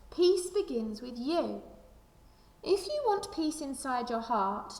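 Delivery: ordinary delivery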